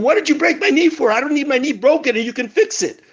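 retold screaming. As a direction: play the injured man's high, frantic yelp, half-acted. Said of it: "And the man starts screaming".

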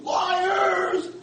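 high loud anguished shout; screaming. Retold or imitated. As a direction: cry out accusing them. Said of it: "He starts screaming".

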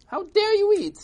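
high, loud scandalised yell; upset. Acted. Crowd scolds old man for eating, high outraged shout. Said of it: "they were all upset".

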